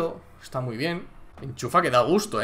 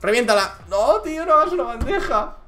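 high loud protest; real frustrated fan shout; dismayed shout; 10 semitones higher, 7 dB louder than usual. Real, not acted. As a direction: yell no at the layup. Begging, then dismay at layup.

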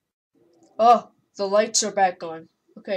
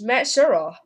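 ordinary delivery

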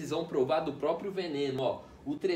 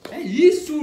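much higher, much louder yell; triumphant shout; 8 semitones higher, 12 dB louder than usual. Real, not acted. Celebrating the successful move.

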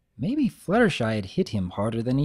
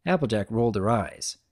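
usual speaking voice

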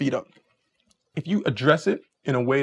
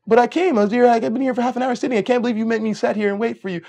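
complaining date; 8 semitones higher than usual. Retold or imitated. Date complaining she waited.

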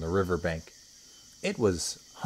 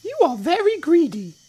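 high, loud accusing little croc; not-so-big crocodile; 10 dB louder than usual. Acted. Not-so-big crocodile accusing the enormous one, high scolding voice. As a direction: scold in a tiny high creature voice.

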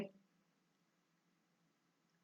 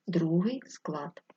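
soft whisper; 7 dB quieter, slower than usual. Shown as a demonstration. Teacher revealing stressed syllable.

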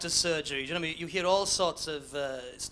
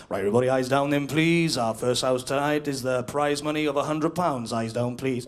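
lower, louder, brisk caller's call; bingo caller; 6 semitones lower, 4 dB louder than usual. Acted.

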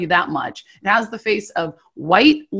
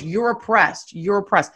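ordinary talk, nothing marked

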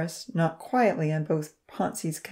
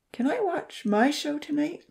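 pleading; 10 semitones higher than usual. A narrator's reading. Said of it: "Lily pleaded".

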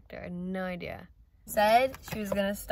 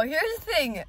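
ordinary talk

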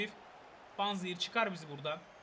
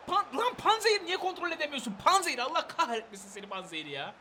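exasperated scolding; 8 semitones higher, 6 dB louder than usual. Real, not acted. Gamer scolding Panzehir for losing the ball, exasperated shouting. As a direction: yell in frustration, then let the pitch fall.

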